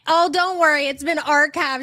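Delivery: normal talking voice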